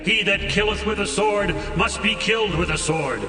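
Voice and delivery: ordinary delivery, nothing marked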